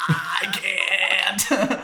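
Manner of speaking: high, strained, stalled "I can't"; short-circuiting. Real, not acted. Put on the spot for an accent, short-circuiting. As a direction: seize up and squeal it out. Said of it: "I just, like, short-circuited".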